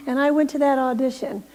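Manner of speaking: ordinary talk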